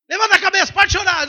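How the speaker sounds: loud, high bossy command; mock scolding comfort. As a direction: order them to cheer up. Parody of pushy comforter.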